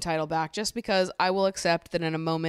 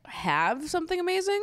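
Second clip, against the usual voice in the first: high, rising disbelieving question; incredulous echo; 12 semitones higher than usual. Retold or imitated. Recapper challenging Tanya's claim about Shaq, incredulous. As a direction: throw the claim back, climbing sharply in pitch.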